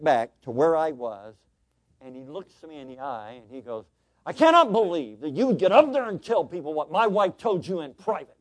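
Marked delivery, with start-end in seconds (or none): 4.3-8.4 loud, high, indignant retelling of an angry man; angry accusation. Retold or imitated. Churchgoer confronting preacher after service, loud angry retelling. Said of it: "He was angry".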